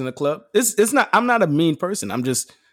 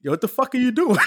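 laughing retold shock; 9 semitones higher than usual. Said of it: "I said".

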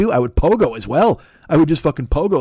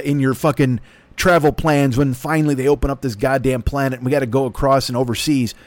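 ordinary talk, nothing marked

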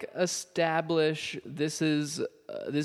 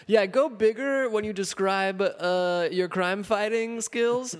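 lightly voiced director, pitched higher; imitated director; 7 semitones higher than usual. Podcaster mimics director asking Jim Cummings to go bigger, lightly acted. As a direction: call out a note to an actor, lightly acted. Voicing a director coaching a voice actor.

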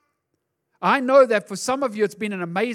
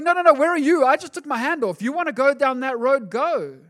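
higher, louder rebuttal as God; God answering back; 5 semitones higher, 5 dB louder than usual. Retold or imitated. God answering the complaining believer, loud and high. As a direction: push the blame right back at them. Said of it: "And God's going".

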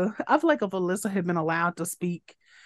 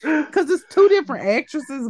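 ordinary talk, nothing marked

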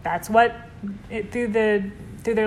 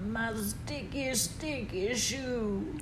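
lightly voiced quirky singing Phoebe; a hint of Phoebe's odd little song; Phoebe impression; 4 semitones higher, slower than usual. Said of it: "is this your impression?".

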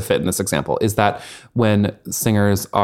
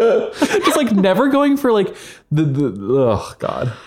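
high giggling words; laughing; 10 semitones higher, slower than usual. Real, not acted. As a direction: talk through a laugh. Laughing at silly names.

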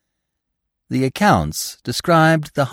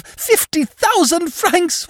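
very high, drawn-out shriek; 3 dB louder, slower than usual. A narrator's reading. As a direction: shriek the sum in outrage, stretching it. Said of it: "continued to shriek at the top of her voice".

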